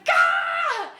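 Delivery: high hammed-up yell of frustration; imitating a furious yelp; mock frustrated shout. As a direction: shriek like a sore loser. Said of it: "He's like".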